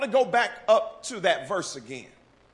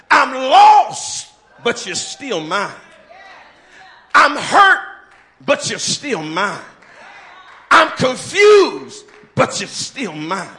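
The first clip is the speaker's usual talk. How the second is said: preaching call and answer; 5 semitones higher, 9 dB louder than usual. Acted.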